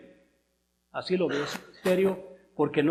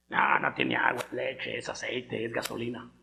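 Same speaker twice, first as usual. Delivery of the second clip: low, slow mock denial; contrary carnal man; 6 semitones lower, 4 dB quieter than usual. Preacher mimics carnal man refusing God's word, contrary low voice. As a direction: drop low and drag out the stubborn denial.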